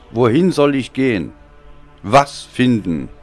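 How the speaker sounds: normal talking voice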